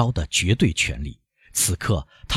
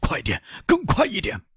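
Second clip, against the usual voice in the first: urgent cry; 13 semitones higher than usual. A narrator's reading. Villefort urges his coachman to race home, urgent cry. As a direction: urge the driver on frantically. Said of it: "他大声地叫道".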